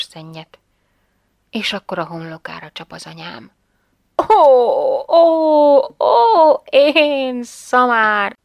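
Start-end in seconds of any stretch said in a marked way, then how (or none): 4.3-8.4 higher, louder, drawn-out groaning oh; sudden realisation. A narrator's reading. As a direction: cry out at her own foolishness. Mother slaps forehead, self-scolds. (homlokára csapó, hangos felismerés)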